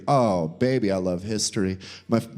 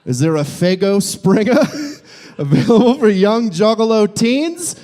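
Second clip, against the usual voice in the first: normal talking voice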